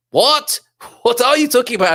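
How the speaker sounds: high, loud disbelief; baffled at the comment, pitch jumping up; incredulous what. Real, not acted. Host reading a comment, reacts in loud disbelief.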